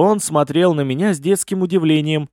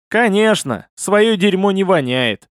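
higher, sarcastic accusation; accusing; 6 semitones higher than usual. A narrator's reading. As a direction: expose him with mocking reproach. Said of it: "обличил я его". (обличающий тон)

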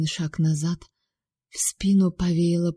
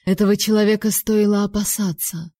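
ordinary delivery

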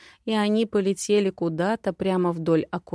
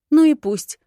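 high, loud, haughty retort; proudly defiant, voice raised; 8 semitones higher, 8 dB louder than usual. A narrator's reading. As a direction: toss it back with chin held high. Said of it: "вздёрнула подбородок".

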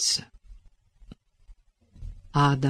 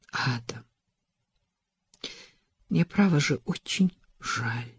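soft, sinking apology; crestfallen voice; 6 dB quieter than usual. A narrator's reading. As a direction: let the voice drop as she apologises. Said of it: "упавшим голосом произнесла Элизабет".

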